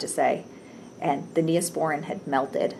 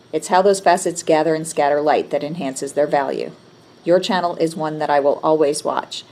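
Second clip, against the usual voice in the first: louder, earnest read-aloud; reading a comment aloud; 7 dB louder than usual. Acted. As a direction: read out the praise proudly. Reads viewer's comment aloud.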